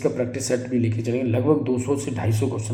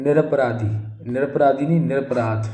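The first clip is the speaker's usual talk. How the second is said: usual speaking voice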